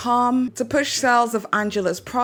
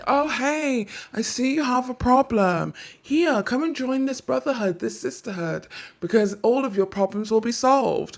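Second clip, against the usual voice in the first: imitating a recruiter; 2 semitones higher than usual. Cult recruiter luring a vulnerable target, lightly mocking sales pitch. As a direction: play the friendly cult recruiter, half-mocking. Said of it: "they will be like".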